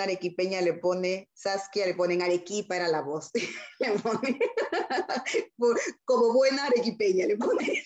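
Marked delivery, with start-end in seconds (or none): 3.3-7.9 giggling through the words, pitch climbing higher; laughing. Real, not acted. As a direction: laugh it out while repeating the phrase. Laughing while repeating the phrase.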